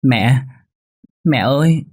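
put-on high, loud childlike wail; crying child calling mom. A narrator's reading. Little boy cries for his mother, high tearful wail. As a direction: cry out for mother like a small boy. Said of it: "Đứa bé thấy mẹ khóc liền khóc theo".

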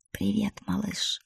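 put-on soft, slow murmur to a baby; tender greeting. A narrator's reading. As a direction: speak softly to the belly. Father greets the unborn baby. (нежно, тихо и медленно)

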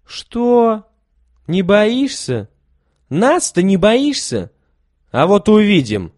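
menacing exclamation. A narrator's reading. Volodya scoffs at the boy who isn't afraid, loud and menacing. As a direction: scoff and threaten him. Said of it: "воскликнул Володя".

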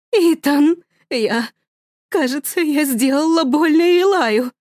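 high, slow, distraught mother; frantic mother. Acted. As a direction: plead to her husband in panic. (высоко, испуганно, растерянно)

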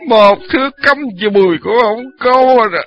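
put-on slow, slurred drunk whine; drunken whining. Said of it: "lải nhải".

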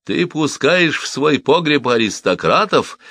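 slow, high-pitched accusation; exclaiming accusation. A narrator's reading. Cobbler accusing the widow, exclaiming. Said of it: "воскликнул Симон".

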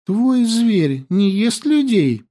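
put-on high, slow, curious girl; girl's voice. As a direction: ask innocently, high. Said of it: "Снова заговорила Янди".

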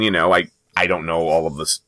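normal talking voice

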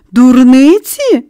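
slow, loud, high outraged cry; boy crying out in outrage at the girl. A narrator's reading. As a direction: cry it out, stung. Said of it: "скрикнув він".

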